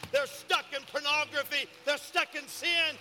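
loud, high fervent preaching; preaching at full force. Acted. Pastor rallying his church for the city, loud fervent preaching. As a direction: build the congregation up, shouting with fire.

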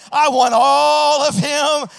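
long, slow, loud cry, pitched high; all-out revival shout. Acted. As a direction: stretch it out as a shout of surrender. Climactic cry of surrender.

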